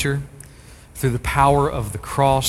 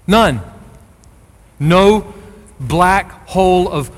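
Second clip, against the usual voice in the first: ordinary talk, nothing marked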